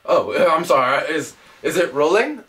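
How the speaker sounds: usual speaking voice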